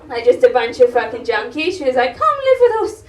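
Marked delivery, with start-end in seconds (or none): 2.2-3.0 high, singsong imitation of mum; gushing mock welcome with a laugh in it; mock cheery invitation. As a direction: imitate an over-friendly mum, pitched way up. Mum inviting junkies to move in.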